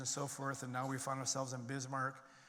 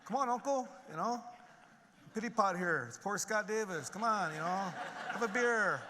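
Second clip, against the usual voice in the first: high, louder mock pleading with uncle; pleading for pity; 7 semitones higher, 4 dB louder than usual. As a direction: wheedle for sympathy, hammed-up and half laughing. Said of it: "for pity".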